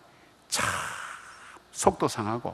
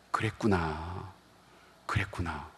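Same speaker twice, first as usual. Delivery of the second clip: soft, slow, softer empathetic "그랬구나"; gentle empathy; 6 semitones lower, 9 dB quieter, slower than usual. Shown as a demonstration. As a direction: soothe her like a listening parent. Pastor models empathetic listening. (공감하며 부드럽고 느리게)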